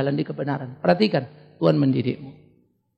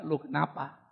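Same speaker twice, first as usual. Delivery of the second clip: soft, lower, unfussed question; calm pastor; 4 semitones lower, 12 dB quieter than usual. Retold or imitated. Pastor asks the upset caller what happened, soft and calm. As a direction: answer the wailing caller quietly.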